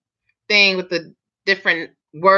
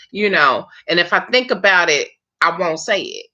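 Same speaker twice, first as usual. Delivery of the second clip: ordinary delivery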